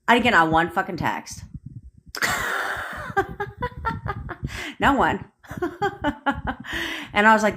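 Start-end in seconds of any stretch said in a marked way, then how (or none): 2.1-4.7 high, soft giggling fit; long helpless laugh; real laughter. Real, not acted. Laughs at own failed attempt. 5.5-7.1 high, light giggle; soft high-pitched laugh; real laughter. Real, not acted. Laughs on after "Not one.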